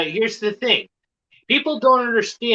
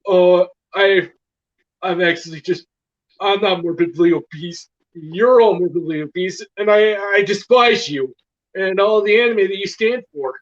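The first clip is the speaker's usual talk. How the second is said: exaggerated smug rant, louder; mock pompous voice; 3 dB louder than usual. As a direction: puff up like a smug critic. Imitating a hated youtuber.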